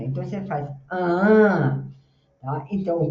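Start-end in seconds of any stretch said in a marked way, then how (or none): 0.9-1.8 "ah" as a long, louder-swelling note tracing the stress; melody of second-syllable stress. Shown as a demonstration. Teacher hums the stress for students, second-syllable melody. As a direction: sustain "ah" as the word's melody. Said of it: "O stress tá na segunda".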